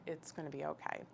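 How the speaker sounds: soft, calm retold comfort; hushed, steady reassurance. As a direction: answer the panic quietly and calmly. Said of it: "my reaction was so calm".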